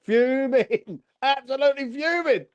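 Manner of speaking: high, drawn-out exasperated fuming. Real, not acted. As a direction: voice climbing in real outrage. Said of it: "I'm fuming".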